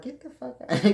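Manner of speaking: high put-on voice, scoffing; mock Dutchman refusal. Vlogger voicing a Dutchman who rejects a British book, high mock scoff. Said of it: "he's like".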